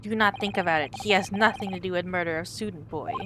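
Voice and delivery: proud Russian sailor introducing himself. Acted.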